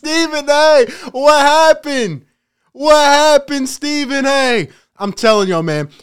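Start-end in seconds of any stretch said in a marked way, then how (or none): 0.0-4.7 high, drawn-out mock sympathy; taunting a pundit with fake pity, calling his name high; mock pleading. Vlogger taunting Stephen A after his apology, high mock pity.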